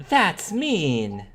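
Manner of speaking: put-on high gasping kid reaction; gasp, then judge it in a child's voice; shocked little sister. Voicing sister's shocked reaction to story.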